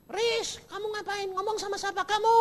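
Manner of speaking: high, quick alarmed mother; mother startled at her son; surprised mother. Retold or imitated. (ibu yang kaget, cepat dan tinggi)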